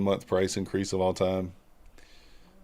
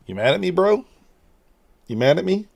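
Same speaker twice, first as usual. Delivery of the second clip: pet talk; 9 semitones higher, 4 dB louder than usual. Real, not acted. Talking to the annoyed cat.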